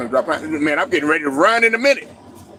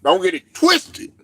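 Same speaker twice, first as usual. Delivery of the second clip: usual speaking voice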